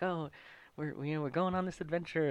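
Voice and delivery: normal talking voice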